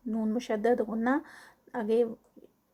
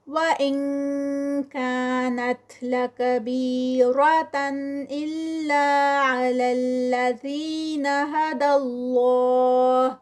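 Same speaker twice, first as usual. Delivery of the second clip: slow, melodic chanted recitation, long vowels held; Quranic recitation; 2 semitones higher, 4 dB louder, slower than usual. Shown as a demonstration. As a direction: chant the verse slowly and melodically. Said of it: "اخفا ہے". (خوش الحانی سے آہستہ تلاوت)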